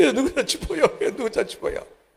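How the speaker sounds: high, hammed-up wail fading off; a chest-beating lament, half-laughed; mock wailing. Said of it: "గుండెలు బాదుకుంటూ ఏడుస్తున్నాడు".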